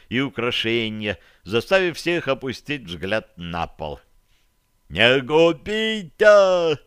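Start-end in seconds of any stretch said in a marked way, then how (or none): 4.9-6.8 high, loud, drawn-out plea; loud begging wail in a raised pitch; pleading cry. A narrator's reading. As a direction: cry out for mercy, stretching the words. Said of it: "вскричал Бурый".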